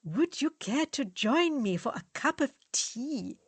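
put-on lightly done posh English accent; a hammed-up English gentleman; exaggerated English accent. Fritz to friends, mocking an English suitor in English accent. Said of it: "says in an exaggerated English accent".

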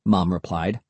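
ordinary delivery

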